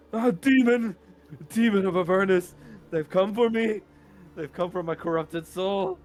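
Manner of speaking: very high, loud panicked cry; frantic terrified yell; terrified shriek. Acted. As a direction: scream in terror of demons. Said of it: "sees you and goes like".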